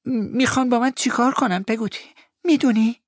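put-on high young voice, anxious; a frightened boy begging to know; child's worried plea. David asks Peggotty what they will do to him, high and worried.

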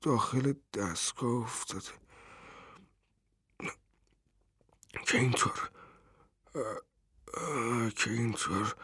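a father taking in his son's death, half whispered; dazed repetition. A narrator's reading. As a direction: echo the words numbly, barely voiced. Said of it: "گیج و منگ تکرار کرد".

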